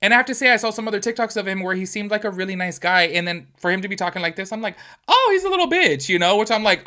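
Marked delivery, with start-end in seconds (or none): 5.1-6.1 high, loud incredulous jab; mock outraged. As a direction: throw it out high and loud in disbelief. Quoting her own reaction.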